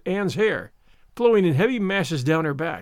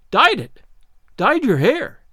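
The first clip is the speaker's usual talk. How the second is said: louder, shocked disbelief; aghast; 5 semitones higher, 6 dB louder, slower than usual. Acted. Marilla appalled that Anne dyed her hair. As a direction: repeat it, appalled and loud.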